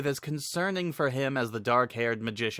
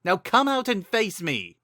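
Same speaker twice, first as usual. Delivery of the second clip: higher, louder heroic shout; hero's yell; 9 semitones higher, 4 dB louder than usual. Acted. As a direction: call out defiantly to the unseen voice. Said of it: "Link yelled".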